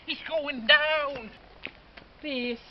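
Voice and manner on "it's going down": excited. Real, not acted. Fuse burning before fireworks.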